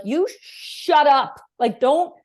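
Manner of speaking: half-joking outburst to shut the topic down; mock angry yell. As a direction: her past self yelling at her partner, hammed up. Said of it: "I'd be like".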